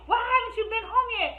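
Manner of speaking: high-pitched mock scolding. Friend on staged rescue call, scolding.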